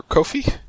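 questioning co-fee; covfefe shortened to co-fee. Shown as a demonstration. Host unsure of the word, tries covfefe as co-fee. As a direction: try covfefe cut down to two syllables. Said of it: "forget how to pronounce it".

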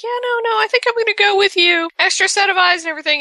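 high, fast, overly eager voice; mock eager Lucy. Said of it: "And then Lucy's like".

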